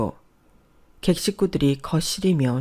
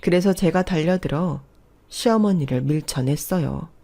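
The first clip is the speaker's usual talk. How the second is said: plain reading voice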